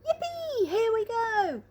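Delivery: put-on an old man thrilled out of his wits; high excited shout. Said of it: "shouted Grandpa Joe".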